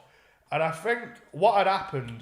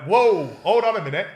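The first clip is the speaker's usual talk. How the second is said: loud, raised-pitch interruption; commanding re-enacted shout; retold halting call; 5 semitones higher, 9 dB louder than usual. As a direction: call a halt to the officers.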